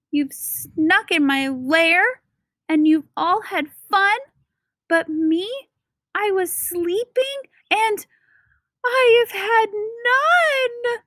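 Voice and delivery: high wailing sad bear voice; hammed-up bear whimper. A narrator's reading. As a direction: whine that he missed the party. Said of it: "And he whimpers and he moans".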